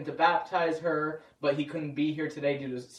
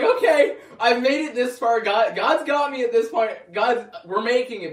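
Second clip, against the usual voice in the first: higher, louder, laughing self-encouragement; frantic hope; 5 semitones higher, 5 dB louder than usual. Retold or imitated. Clinging to the handle as his mom speeds, frantic laughing hope. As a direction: talk yourself through the wild drive. Holding on, scared in speeding car.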